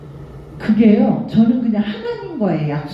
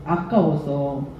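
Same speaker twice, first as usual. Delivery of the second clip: ordinary delivery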